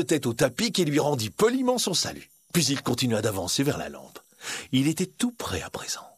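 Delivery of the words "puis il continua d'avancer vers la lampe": usual speaking voice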